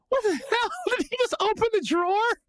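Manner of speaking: high, squealed disbelief; incredulous. Retold or imitated. Suspect watching guard search a drawer, high incredulous squeal. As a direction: replay your amazement with the pitch way up. Retold amazement at absurd search.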